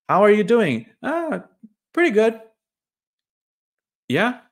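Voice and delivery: high, loud, chirpy fake-happy small talk; fake cheerful. Faking happiness when a friend asks how you are, high and bright. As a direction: ham up the pretend-happy greeting.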